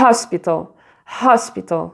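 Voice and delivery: "hospital" with a breathy aspirated h; English "hospital" with an h. Shown as a demonstration. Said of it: "donc tu l'aspires".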